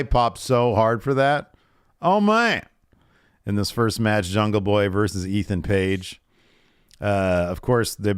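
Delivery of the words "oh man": high, loud oh man of delight; excited oh man. Real, not acted. To co-host, reliving the pop with excitement.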